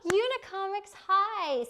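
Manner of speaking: thrilled to spot a friend in chat; excited greeting. Real, not acted. Streamer greeting her avatar artist, excited.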